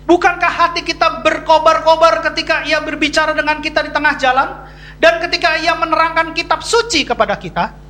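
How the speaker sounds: high, declaimed Bible verse; scripture reading aloud. Acted. Preacher reading the disciples' words to the congregation, declaimed.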